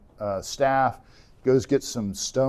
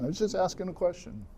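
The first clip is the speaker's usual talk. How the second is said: put-on softer whiny kid; 8 semitones higher, 8 dB quieter, faster than usual. David defends himself to brother.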